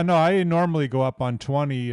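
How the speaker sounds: ordinary delivery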